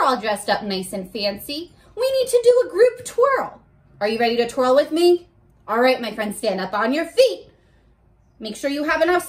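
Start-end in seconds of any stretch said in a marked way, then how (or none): 2.0-5.3 louder, fast invitation to twirl; excited call. Real, not acted. Teacher rallying students for a twirl, excited. Calling kids to group twirl.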